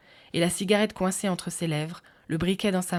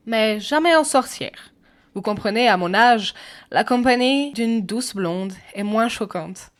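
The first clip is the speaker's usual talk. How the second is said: put-on stern English accent; 4 semitones higher, 7 dB louder than usual. A narrator's reading. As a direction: reprove severely in an English accent, drawing words out. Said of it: "affiche un visage sévère".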